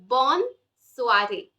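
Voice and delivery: "bonne soirée" slowly, with a full n in bonne; touch the tongue up for the n in "bonne soirée"; drawn-out "bonne soirée", n clearly heard; sounded double N. Shown as a demonstration. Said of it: "The tongue touches the upper part".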